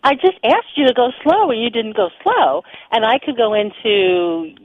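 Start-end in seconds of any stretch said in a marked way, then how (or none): 0.0-2.6 higher-pitched reproach; a touch of hurt indignation, pitched up; triggered complaint. Acted. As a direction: play someone mildly triggered and accusing. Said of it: "get triggered and feel like".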